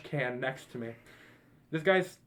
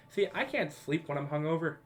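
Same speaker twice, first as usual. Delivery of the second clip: normal talking voice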